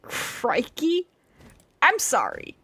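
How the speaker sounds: ordinary talk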